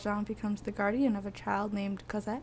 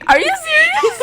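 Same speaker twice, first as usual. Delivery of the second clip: disbelieving laugh, much higher; 14 dB louder than usual. Real, not acted. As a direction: squeal it out through laughter.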